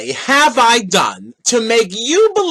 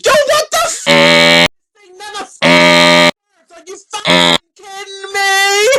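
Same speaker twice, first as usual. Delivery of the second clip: mock outrage at buffering; 9 semitones higher, slower than usual. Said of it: "you're over here like".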